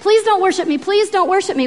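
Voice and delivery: high, insistent pleading; acted urgent plea; urgent begging. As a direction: beg them to stop, pitch held up. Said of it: "Paul's urgently begging them".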